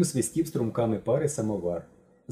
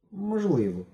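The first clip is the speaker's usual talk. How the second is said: uncertain; 8 semitones higher, slower than usual. A narrator's reading. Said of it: "непевно відповів Мишко".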